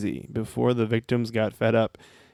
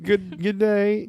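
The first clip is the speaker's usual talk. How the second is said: drawn-out high Australian good day; slow mock Australian accent; 7 semitones higher, much slower than usual. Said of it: "it's an Australian accent".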